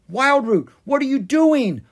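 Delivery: high, loud alarmed shout; alarmed yell. Acted. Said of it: "Oscar yelled".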